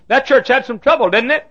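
high, loud leading query; loud probing question. Retold or imitated. Visiting preacher probing the local pastor, loud question. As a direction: press him loudly for the gossip.

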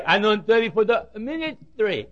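mushy garbled ministry as minimi; mock tongue-tied. Preacher mimicking Joe's garbled speech, light mockery. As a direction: blur the consonants like Joe. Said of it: "can't even talk plainly".

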